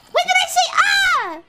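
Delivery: high-pitched protest; indignant shout. Real, not acted. Streamer protesting to the game, high indignant shout. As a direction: yell it in disbelief. Protests the game's word detection.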